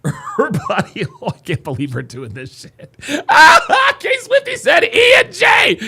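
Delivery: high, breaking laughter through the words; can barely get the words out for laughing; laughing hard. Real, not acted. Streamer cracking up at chat's pick, laughing hard.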